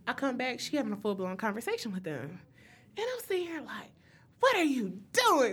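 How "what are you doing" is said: high, loud mock outrage; incredulous, punched-up question; acting out a loud "excuse me?"; exasperated disbelief. Acts out reaction to her friend.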